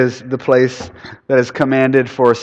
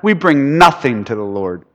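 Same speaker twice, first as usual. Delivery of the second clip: usual speaking voice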